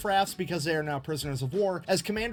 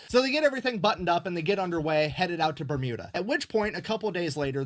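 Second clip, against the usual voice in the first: ordinary talk, nothing marked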